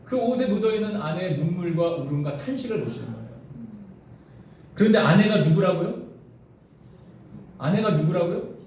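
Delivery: ordinary talk, nothing marked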